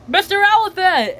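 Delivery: high, loud acted call; calling for help. Miss Rabbit calls Mister Elephant to the rescue, high loud call.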